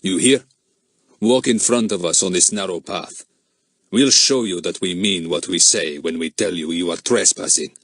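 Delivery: put-on stranger marching the children off; grim foreign man. A narrator's reading. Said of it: "said the second man to Fatty grimly".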